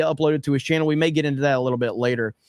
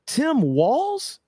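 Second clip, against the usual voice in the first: incredulous; 8 semitones higher than usual. Real, not acted. Incredulous about the governor.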